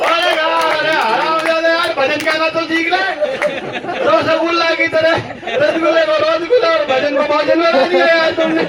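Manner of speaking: fed up, throwing up your hands; exasperated outburst. Retold or imitated. Punchline outburst at the Bengali host.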